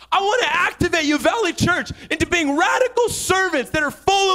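rally the church to serve; fiery preaching. Real, not acted. Preacher at full force.

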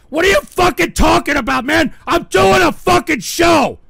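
loud, high-pitched furious outburst; angry yelling. Real, not acted. Host blowing up at a donor who complains, angry yelling. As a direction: yell it, exasperated, at full force.

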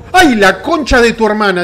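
sudden loud curse of fright; startled yell. Real, not acted. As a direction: jump out of your skin and yell. Startled by a game jump scare.

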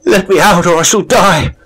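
high, loud panicked shout; terrified scream. Acted. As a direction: beg for your life at the door. Locked in, terrified, begging.

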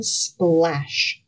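ordinary delivery, nothing marked

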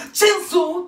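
loud, high shout of チェーンソー; mock chainsaw yell. Vlogger imagines a TV personality charging in, yelling. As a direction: bellow チェーンソー like a movie villain. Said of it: "ってやってくるかもしれない".

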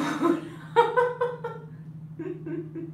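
long, high-pitched laugh; helpless laugh at a live mishap. Real, not acted.

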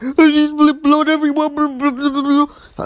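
high, loud mocking squeal of the panicking sister; freaked-out sister. Mocking the younger sister freaking out on the phone. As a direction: squeak it like a hysterical little sister. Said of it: "she's, like, crazy".

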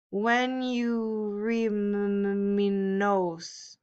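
slow, halting stammer. A narrator's reading. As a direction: stumble over each word slowly. Character stammers slowly.

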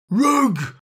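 high, loud furious scream of "Rug!"; dog screaming at the Rugs by the window. Acted. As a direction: scream it out with fury and dismay. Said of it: "Boris screamed".

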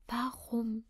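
whispered, aching question; despairing whisper. Acted. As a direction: breathe it out in despair. Verzweifelte Frage, dreimal wiederholt. (geflüstert, verzweifelt)

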